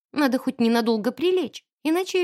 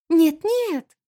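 higher, louder anxious denial; desperate denial; 6 semitones higher, 5 dB louder than usual. A narrator's reading. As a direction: deny it in a fluster. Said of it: "она отчаянно замотала головой". (отчаянно отрицая)

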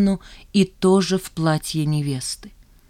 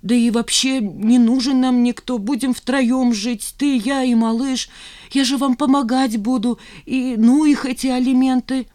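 eager mother; 7 semitones higher than usual. Acted. Mother planning to raise baby together, eager plea. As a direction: plead brightly for a family.

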